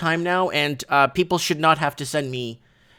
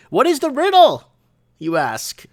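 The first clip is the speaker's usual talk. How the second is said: much higher and louder, a showman's question; showman tease; 8 semitones higher, 4 dB louder than usual. Acted. Host hyping his riddle segment to viewers, high and loud. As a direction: tease it like a game-show host.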